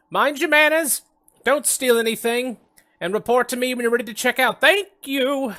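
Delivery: high, loud shouting librarian; muffled shout. Acted. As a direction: yell it from the back of the library. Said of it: "a muffled voice from the back".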